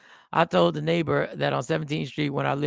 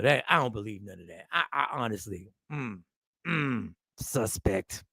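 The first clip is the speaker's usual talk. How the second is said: a low, hushed hint of a doubting critic; mock skeptic; 7 semitones lower than usual. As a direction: play the suspicious commenter sniffing out a fake. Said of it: "He was like".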